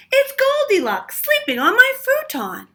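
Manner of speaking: put-on very high, loud child's discovery; a little boy pointing her out; excited child. A narrator's reading.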